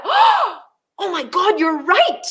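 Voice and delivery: high, drawn-out revelation after a gasp; amazed realisation. Retold or imitated. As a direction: act out a sudden epiphany.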